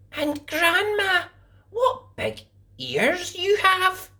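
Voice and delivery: put-on high, slow amazed girl; little girl's wonder. A narrator's reading. Girl wondering at grandma's eyes, high and slow. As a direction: marvel wide-eyed like a child.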